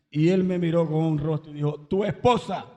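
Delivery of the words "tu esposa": stern rebuke. Retold or imitated. Jesus sternly corrects him.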